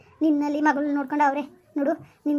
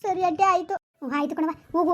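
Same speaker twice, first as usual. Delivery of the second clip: normal talking voice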